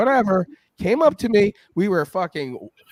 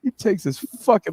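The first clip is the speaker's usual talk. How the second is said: ordinary talk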